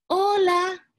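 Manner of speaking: put-on high, bright girlish hello; a sweet little girl greeting. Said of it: "dijo ella".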